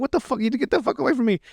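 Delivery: high, imitated cries of a cornered victim; muffled panicked protest. Voicing Timmy backed into the corner, muffled panicked protest. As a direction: keep the mock panic high but held down. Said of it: "It's all, like, muffled".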